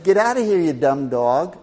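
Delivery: annoyed shooing. Retold or imitated. Half-asleep owner to his barking dog, mock annoyed. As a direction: shoo the dog off with a loud retold grumble.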